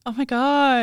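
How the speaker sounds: shocked gasp. Real, not acted. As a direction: gasp then loud oh my gosh. Shocked he never saw film.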